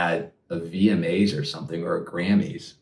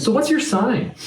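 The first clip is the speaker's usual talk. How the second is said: squeaky high mock question. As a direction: pitch way up, teasing. Acted fan asking star sign.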